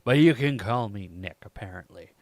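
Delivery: low, measured inner monologue; hero's narration. Acted. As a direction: introduce the case as the lawyer hero, low and composed.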